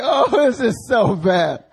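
high, loud, drawn-out crying voice; hammed-up tearful lament; dramatic mock wail. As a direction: wail it slowly, hamming up the misery. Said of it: "he's being all dramatic".

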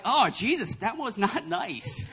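high, drawn-out playful reproach; mock disapproval. Reader chiding Jesus over the funeral scene, playfully disapproving. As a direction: gently tell someone off, slowly. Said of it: "when I'm reading this, I'm like".

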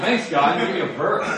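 loud, laughing mock gratitude; laughing teasing thanks to God; laughing sarcastic thanks. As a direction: laugh it out as a cheeky complaint. Joking thanks after God's silence.

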